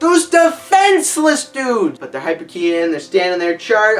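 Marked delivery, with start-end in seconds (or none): 0.0-2.0 high, loud, slow sarcastic villain voice; high, loud, slow mock command. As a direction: ridicule the villain by overdoing him. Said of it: "He's like".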